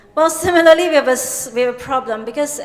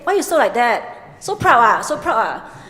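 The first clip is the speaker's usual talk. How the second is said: Singlish teasing; 3 dB louder than usual. Retold or imitated. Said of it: "they'll say".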